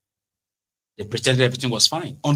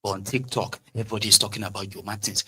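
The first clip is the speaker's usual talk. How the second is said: ordinary talk, nothing marked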